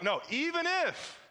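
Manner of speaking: high, drawn-out defiant refusal; defiant boys. Acted. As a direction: stretch it out high, refusing to budge. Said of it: "these boys are like".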